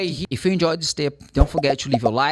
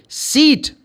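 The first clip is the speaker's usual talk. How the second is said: "Sit." shouted higher and louder, short I; wrong word; 13 semitones higher, 7 dB louder than usual. Acted. Student wrongly calls out "Sit." to the teacher, loud. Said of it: "No, it's not right".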